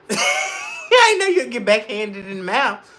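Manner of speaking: slow, laughing delivery; amused and slow. Real, not acted. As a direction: laugh through the line. Laughing at a story.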